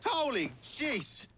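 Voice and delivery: high, slow startled cry of delight; surprised exclamation. Acted. Reunited with an old comrade, startled high exclamation. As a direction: caught off guard by a familiar face.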